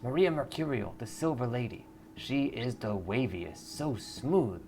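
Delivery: lightly voiced blissed-out fan; dreamy fan. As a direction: gush about her like you're floating. Stoned fan praises singer.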